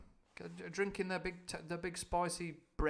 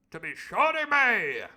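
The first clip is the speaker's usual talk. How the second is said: high loud slow pompous reply; the Squire; 8 semitones higher, 12 dB louder, slower than usual. Acted. The Squire agreeing, high and loud. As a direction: grant it grandly. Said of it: "says Squire".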